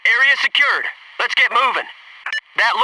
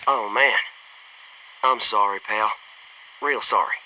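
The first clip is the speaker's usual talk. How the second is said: quiet sorrow; 10 semitones lower, 6 dB quieter than usual. Acted. Mourning a fallen ally.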